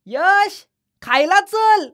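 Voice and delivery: put-on high child calling from afar. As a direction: call the boy in to eat, sing-song. Nikita calls Yash to eat. (यशला हाक मारणारी मुलगी, उंच आवाजात)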